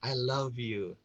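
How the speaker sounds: mean it, gently and quietly; sincere declaration. Shown as a demonstration. Coach demonstrating the first example to listeners, sincere and soft. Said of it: "Yang pertama ya, Anda ucapkan".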